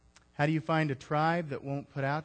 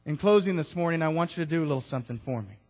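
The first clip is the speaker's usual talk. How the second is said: ordinary talk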